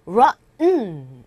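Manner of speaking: ROTTEN with the T swallowed into a nasal N. Shown as a demonstration. English teacher showing natives' pronunciation, ROTTEN with a swallowed T. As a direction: stop short in ROTTEN, then hum the N. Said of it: "트 발음이 안 들리고요".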